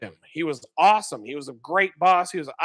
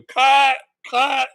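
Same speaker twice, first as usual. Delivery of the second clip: loud, higher call of cut; 10 semitones higher, 6 dB louder than usual. Retold or imitated. Film crew halting the scene, loud call. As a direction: shout it across the set. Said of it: "they're like".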